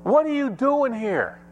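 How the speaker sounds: high, insistent preaching question; demanding pulpit question; preacher's challenge. Real, not acted. Preacher's challenge, later echoed as small voice.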